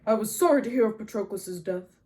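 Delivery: loud, stately commander's voice; Agamemnon, formal condolence. A narrator's reading. Agamemnon offering condolence as he leaves, loud and formal. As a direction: deliver sympathy as a public pronouncement.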